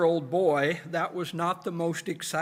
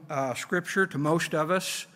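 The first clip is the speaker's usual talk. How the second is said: usual speaking voice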